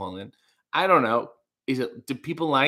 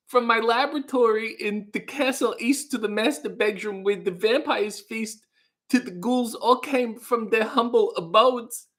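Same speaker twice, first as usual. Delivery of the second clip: higher-pitched impression, reciting novelty song lyrics; half-sung put-on celebrity voice; 4 semitones higher than usual. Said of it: "[name] cover of Monster Mash".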